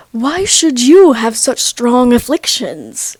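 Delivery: slow, hammed-up verbose indignation; overdone archaic formality; mock old-timey. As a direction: draw it out like stilted period drama. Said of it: "they didn't talk like that".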